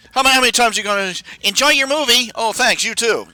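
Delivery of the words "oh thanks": ordinary delivery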